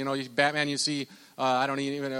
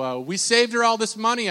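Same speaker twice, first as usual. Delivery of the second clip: ordinary talk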